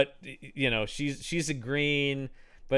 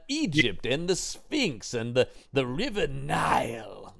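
needlessly dramatic. Acted. Host imitating the star's college lecture, needlessly dramatic. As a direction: lecture declaimed like a ham actor. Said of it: "just needlessly dramatic".

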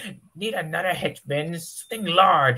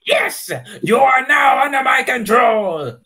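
triumphant evil yell; villain shout; 5 semitones higher, 7 dB louder than usual. Acted. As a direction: bellow it in triumph.

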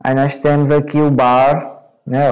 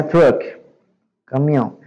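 ordinary delivery

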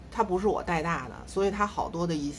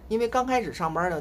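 ordinary talk

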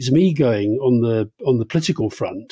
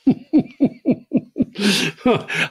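high, sustained chuckling laugh; real laughter; 9 semitones higher than usual. Real, not acted. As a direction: burst into a run of helpless giggles. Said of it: "I guess I'm laughing now".

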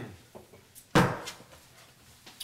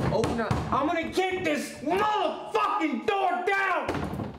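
furious shout; 13 semitones higher than usual. Acted. Drunk husband locked out by his wife, furious yelling. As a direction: threaten through the shut door.

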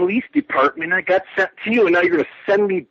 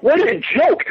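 scoffing outrage; 8 semitones higher, slower than usual. Acted. Caller mocks police runaround.

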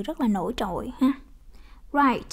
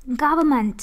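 "Government" with a loud first syllable, falling away; stress on first syllable; 5 dB louder than usual. Shown as a demonstration. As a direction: hit the GOV in "Government". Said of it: "là nhấn âm một".

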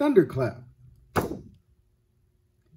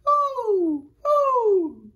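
high hooting owl call; 7 dB louder than usual. Shown as a demonstration. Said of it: "I would like you to do this".

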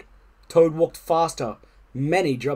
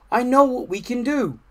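higher-pitched storybook character voice; encouraging Frog; 8 semitones higher than usual. A narrator's reading. Frog to Toad after losing the cones, encouraging. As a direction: offer the bright idea encouragingly.

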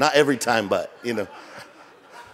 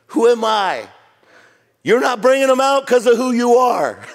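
much higher, louder correction; preaching loud; 8 semitones higher, 5 dB louder than usual. Real, not acted. Preacher calls out the congregation's doubt, loud. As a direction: drive home the wrong question. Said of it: "Wrong question".

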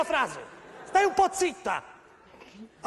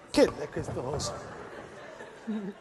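ordinary delivery

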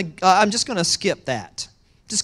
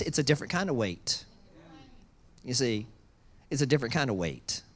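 quiet confiding; 7 semitones lower, 8 dB quieter than usual. Acted. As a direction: drop softer and lower. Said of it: "drops to soft, quiet".

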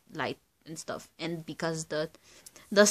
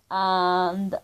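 usual speaking voice

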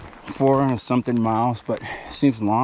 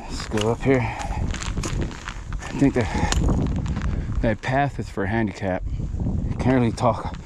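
stiff lips from the cold; cold numbed mouth; slower than usual. Real, not acted. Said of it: "So I'm talking kinda weird".

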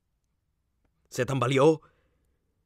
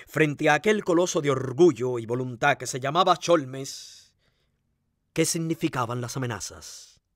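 ordinary delivery, nothing marked

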